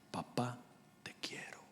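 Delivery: whispered, tender and intimate; soft whispered "te quiero". Acted. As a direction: breathe it like a secret love. Tender confession to father, whispered.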